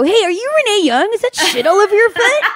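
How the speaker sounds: put-on high-pitched kid voice; little boy. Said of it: "did that little boy come out".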